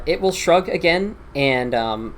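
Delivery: usual speaking voice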